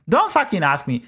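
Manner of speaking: usual speaking voice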